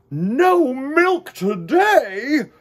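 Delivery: high, loud, slow cry of dismay; crying out in shock. Acted. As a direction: wail it in disbelief. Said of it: "cried Farmer Brown".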